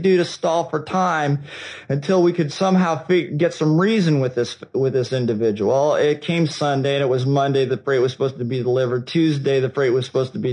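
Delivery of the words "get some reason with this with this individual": normal talking voice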